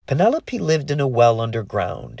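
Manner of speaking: plain reading voice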